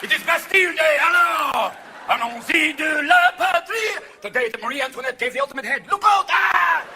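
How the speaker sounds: put-on loud, high French-accented proclaiming; hammed-up French accent. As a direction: shout it like a proud Frenchman. Mock French Bastille Day.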